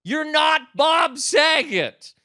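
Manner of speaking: high, loud, drawn-out yell; imitated heckler's scream. Heckler to the opener in a packed theater, imitated scream. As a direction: yell it slowly across a huge theater. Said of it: "yells, screams in a twelve hundred seat theater".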